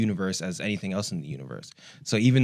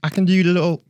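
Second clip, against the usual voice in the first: put-on higher, louder posh British accent; attempted British accent, higher and louder; 8 semitones higher, 5 dB louder than usual. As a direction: play it stiff upper lip British. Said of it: "What's your best British accent".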